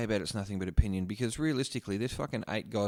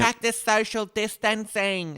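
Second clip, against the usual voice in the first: high, slow mocking singsong; high slow mock parroting; 10 semitones higher, 5 dB louder, much slower than usual. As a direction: parrot the slogan like a simpleton.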